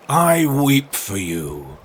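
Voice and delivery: slow, drawn-out mock sorrow; weeping walrus. Walrus pitying oysters he eats, slow false sorrow. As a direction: lament it slowly and grandly.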